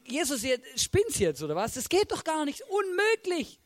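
high-pitched, incredulous protest; disbelieving disciples. Acted. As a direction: object as if it is absurd. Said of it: "weil die Jünger sagen".

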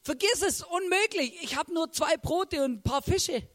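high-pitched protest to God; acted-out, incredulous refusal; dismissive first reaction. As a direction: brush it off as impossible. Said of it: "meine erste Reaktion ist".

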